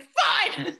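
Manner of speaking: high, exasperated giving in; mock tearful outburst. Bawling mom giving in to the secretary, wailed. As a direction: cry it out in mock defeat. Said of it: "I'm screaming back at her".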